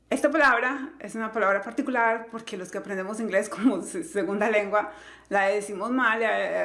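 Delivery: ordinary talk, nothing marked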